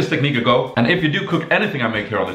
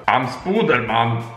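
silly Spooderman voice; slower than usual. Pretend. Reads fan's joke line.

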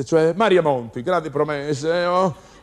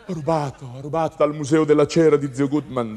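normal talking voice

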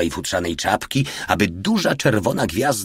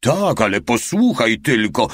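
higher, moderately raised voice; yelling; 4 semitones higher, 4 dB louder than usual. A narrator's reading. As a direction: half-shout to make him listen. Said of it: "darł się mały jeszcze głośniej". (podniesiony głos, umiarkowany krzyk)

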